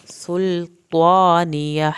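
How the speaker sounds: stretched tajweed recitation; Quran recitation. Shown as a demonstration. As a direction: chant it out slowly with a full heavy ṭā. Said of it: "توا کو پر کرنا ہے".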